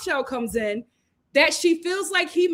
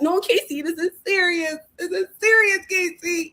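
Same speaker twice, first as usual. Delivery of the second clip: higher-pitched, whiny imitation of crying; mock crying plea; 5 semitones higher than usual. Crying girlfriend begs him to be serious, mock tearful plea. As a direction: plead like a tearful girlfriend, lightly hammed up. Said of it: "she is really serious and she's crying".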